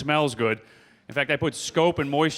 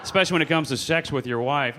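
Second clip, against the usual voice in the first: normal talking voice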